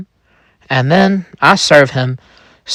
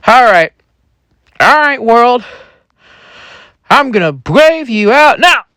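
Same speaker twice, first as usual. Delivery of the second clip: high-pitched shout-out to the world; acted high, defiant proclamation; rallying cry; 11 semitones higher than usual. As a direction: declare it boldly, way up high. Man stands, addresses the world.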